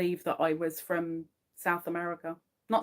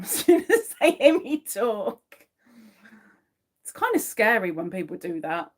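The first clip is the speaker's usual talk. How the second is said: ordinary talk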